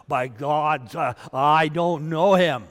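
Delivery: loud, emphatic denial; adamant oath. Acted. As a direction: swear it loudly and forcefully.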